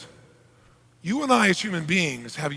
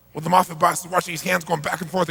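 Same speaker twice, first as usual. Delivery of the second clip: ordinary talk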